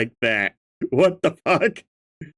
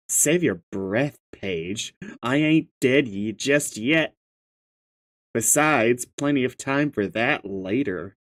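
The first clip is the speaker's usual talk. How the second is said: low, softer in-character read; 4 semitones lower, 5 dB quieter than usual. Acted. Telling Paige to save breath, low character voice. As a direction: drop the pitch and deliver it restrained. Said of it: "gotta read this in the correct voice".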